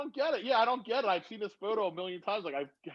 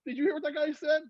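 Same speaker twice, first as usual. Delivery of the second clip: shocked disbelief; 13 semitones higher than usual. Retold or imitated. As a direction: relive the jolt of a spooky coincidence. Retelling his shocked reaction.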